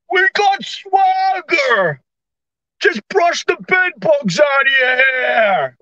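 mock swagger. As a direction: stretch the line endings in sarcastic pride. Mocking a politician's swaggering message.

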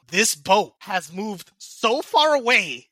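slow drawn-out words rising into a near shout; building disbelief. Retold or imitated. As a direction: stretch it out, then yell the end. Retelling panic as boat drifted.

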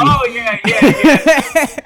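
excited agreement. Real, not acted. Excited agreement, much louder and higher.

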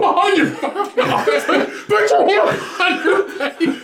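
high, drawn-out arrest order, laughing; mock police command. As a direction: play a cop yelling orders, stretched out.

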